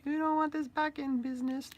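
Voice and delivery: very high squeaky threat; high squeaky doll warning; creepy toy voice. Acted. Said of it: "That's how he talks".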